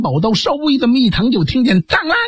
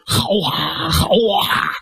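crowd cheering; much slower than usual. Acted. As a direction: call out praise like a whole crowd applauding. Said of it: "齐声喝彩".

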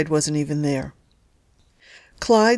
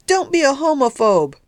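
high, louder rebuke; shouting; 8 semitones higher, 3 dB louder than usual. A narrator's reading. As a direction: daughter shouting back from upstairs. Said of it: "shouted Vicky from somewhere upstairs".

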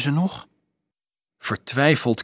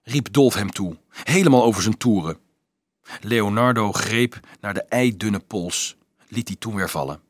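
ordinary delivery, nothing marked

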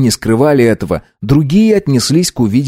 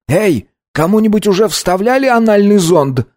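loud; 11 semitones higher than usual. A narrator's reading. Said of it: "громко осведомился Саймон".

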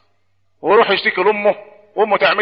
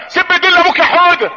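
high-pitched, stretched parental coaxing; mock egging-on parent; 10 semitones higher, 3 dB louder than usual. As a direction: imitate a parent urging a toddler to curse. Parent eggs on child, mocked. (تقليد تشجيع طفل بصوت عالي الطبقة)

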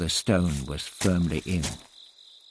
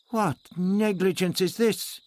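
indignant demand, pitched up; 12 semitones higher than usual. A narrator's reading. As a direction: demand an answer with mild outrage. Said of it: "he demanded".

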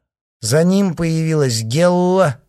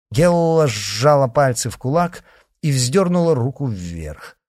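plain reading voice

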